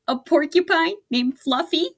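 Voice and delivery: high, loud mocking howl; howling with laughter. A narrator's reading. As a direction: hoot the name in disbelief. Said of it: "howled the rhinoceros".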